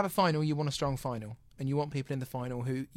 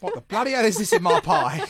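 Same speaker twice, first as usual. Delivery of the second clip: loud, hammed-up Cockney outrage; Cockney Paul; 4 semitones higher, 8 dB louder than usual. Judge finding paper in his pie, comic Cockney outrage. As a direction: bellow it in a mock Cockney accent. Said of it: "Co-, Cockney Paul".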